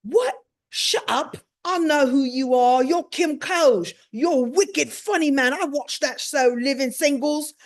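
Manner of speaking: loud, high imitation of a thrilled British man; British accent, delighted recognition; excited British accent. Idris recognizing the show's host, excited British accent. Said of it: "And he says to me".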